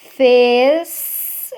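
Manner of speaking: "Fez" stretched out loud on its own; single out "Fez" as a syllable; long, emphatic "Fez" with a pause after; isolated syllable. Shown as a demonstration. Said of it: "é outra sílaba".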